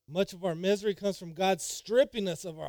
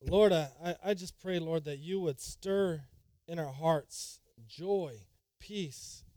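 prayer; slower than usual. Acted. Opening prayer.

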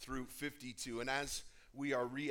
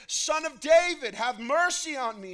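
even louder, high-pitched desperate plea; crying out all the more; 13 semitones higher, 14 dB louder than usual. A narrator's reading. Rebuked beggar calls harder to Jesus, crying out all the more. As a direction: shout it out, refusing to be silenced. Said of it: "he cried out all the more".

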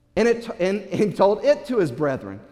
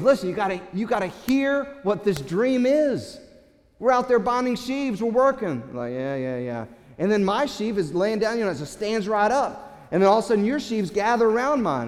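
higher, excited pleading tone; eager younger brother; 4 semitones higher than usual. Acted. Joseph insisting his brothers hear the dream, higher and excited. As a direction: push the dream on them eagerly. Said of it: "he goes".

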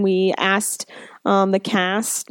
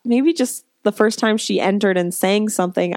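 ordinary talk, nothing marked

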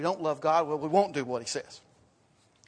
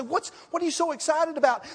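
curious questioner; 9 semitones higher than usual. Retold or imitated. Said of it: "You say".

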